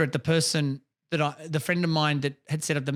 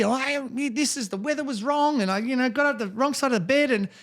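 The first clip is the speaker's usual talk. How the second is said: higher whiny excuse-maker voice; hammed-up whiny excuse, pitched up; mock excuse story; 6 semitones higher than usual. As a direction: act out a rambling lame excuse. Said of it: "bullshit story".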